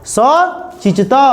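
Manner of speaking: very slow, stretched सचितः, each syllable drawn out; drawn-out model pronunciation. Shown as a demonstration. Teacher modelling the word for students, slow and drawn out. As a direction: stretch सचितः out slowly for the class.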